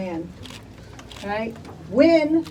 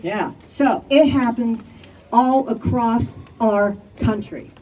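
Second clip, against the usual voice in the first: usual speaking voice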